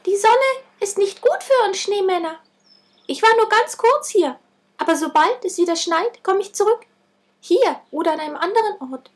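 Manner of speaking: tiny high squeak; squeaky voice. A narrator's reading. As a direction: squeak it like a little creature. Melting snowman speaks tiny.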